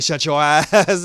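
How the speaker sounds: loud, slow, laughing snap; hammed-up angry [name]; mock angry retort. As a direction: bark it at her then crack up. [name] snapping at Astra.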